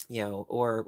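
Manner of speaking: normal talking voice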